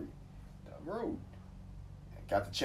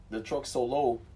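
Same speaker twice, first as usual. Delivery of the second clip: ordinary talk, nothing marked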